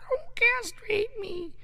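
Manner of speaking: very high, soft and slow cat plea. Pretend. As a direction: drag it out like a scared kitten. Owner voicing the pleading cat.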